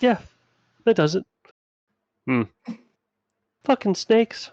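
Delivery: high-pitched, grumbling fed-up guard; annoyed guard. Acted. Guard reacts to snake noise.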